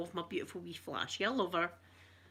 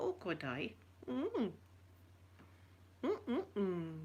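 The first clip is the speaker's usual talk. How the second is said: soft swoon; 10 dB quieter, much slower than usual. Retold or imitated. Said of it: "I thought".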